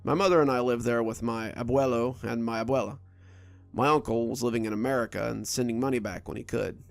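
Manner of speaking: plain reading voice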